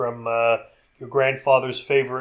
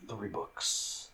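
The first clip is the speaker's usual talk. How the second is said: whispered dismay; 5 semitones lower, 17 dB quieter than usual. Retold or imitated. Acting out Ash's dismay.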